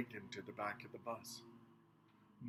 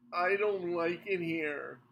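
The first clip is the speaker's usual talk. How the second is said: whining; 6 semitones higher, 7 dB louder, slower than usual. A narrator's reading. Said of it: "Minivan whined".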